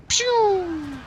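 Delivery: high mouth-made pew sound; arrow sound effect. Pretend. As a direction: imitate an arrow flying off. Imitating an arrow shot.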